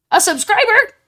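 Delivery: high, loud excited cry; thrilled disbelief. Real, not acted. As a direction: cry out at a wonderful surprise. First subscriber arrives.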